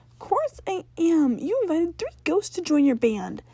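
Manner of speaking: put-on older sister snapping at her brother about his band; upset older sister voice. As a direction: play the big sister, fed up and scolding.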